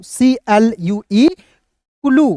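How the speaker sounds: drawn-out letter dictation; slow spelling out. Shown as a demonstration. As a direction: spell सीएलयूई slowly, letter by letter. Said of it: "इसका उच्चारण". (धीरे-धीरे अक्षर बोलना)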